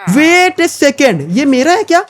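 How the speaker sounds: high, stunned disbelief, drawn out; surprised. Real, not acted. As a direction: gasp as if you can't believe your eyes. Sudden shock at own house. (हैरानी से ऊँची आवाज़ में)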